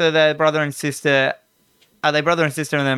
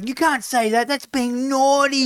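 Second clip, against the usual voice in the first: put-on shocked little kid; 10 semitones higher than usual. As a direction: gasp it out like a horrified little boy. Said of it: "then he goes".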